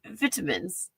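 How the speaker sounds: vitamins as VIT-a-mins in a British accent; a quiet British VIT-a-mins. Shown as a demonstration. Showing viewers the British way, British accent. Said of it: "how British people say".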